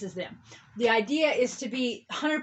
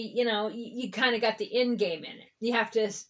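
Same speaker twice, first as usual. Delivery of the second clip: normal talking voice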